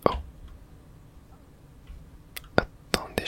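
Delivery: normal talking voice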